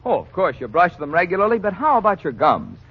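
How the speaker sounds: ordinary talk, nothing marked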